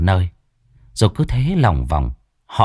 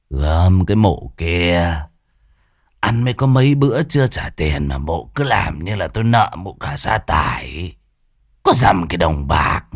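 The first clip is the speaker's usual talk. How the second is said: put-on slurred drunk. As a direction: drag the words like a drunk. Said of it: "giọng lè nhè".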